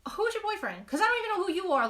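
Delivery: high, fast, baffled reply; incredulous retort. Retold or imitated. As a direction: act like you have no clue who he is. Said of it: "I'm like".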